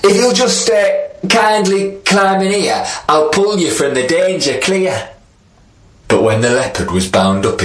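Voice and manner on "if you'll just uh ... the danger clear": spider. Acted. Spider tricks the leopard.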